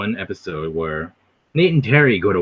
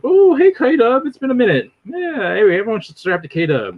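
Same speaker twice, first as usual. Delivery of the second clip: high excited greeting, gradually coming down; Vocab excited; 11 semitones higher, 4 dB louder than usual. Acted. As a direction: imitate a streamer spotting a friend, starting very high. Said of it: "He gets excited".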